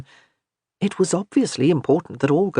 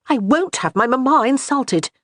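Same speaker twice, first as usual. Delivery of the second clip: higher, louder sharp retort; snapping; 8 semitones higher, 4 dB louder than usual. A narrator's reading. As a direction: snap back defensively. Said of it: "she snapped".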